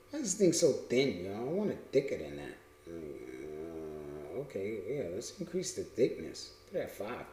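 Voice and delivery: low, hushed self-talk; muttering to oneself. Real, not acted. Speaker fixing the line thickness, muttering to self. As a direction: think aloud under your breath.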